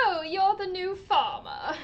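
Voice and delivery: high, loud put-on British accent with a cry in it; exaggerated imitation of a British accent; mock British accent. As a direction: play at being Elliot the British farmer's neighbour. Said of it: "suddenly gets a British accent".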